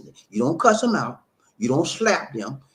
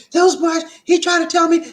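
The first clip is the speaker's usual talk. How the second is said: high, loud, worked-up retelling; upset wife; 12 semitones higher, 8 dB louder than usual. Retold or imitated. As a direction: imitate an upset wife blurting it out. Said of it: "She was so upset".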